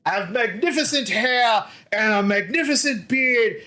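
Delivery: proud dwarf. Acted. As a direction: puff up and demand an apology. Offended dwarf boasting hair.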